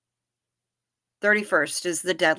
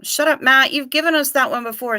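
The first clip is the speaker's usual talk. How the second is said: higher and louder, mock exasperated; mock shout; 6 semitones higher, 6 dB louder than usual. Teasing a chatter who repeats himself, mock shout. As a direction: snap at him in jest.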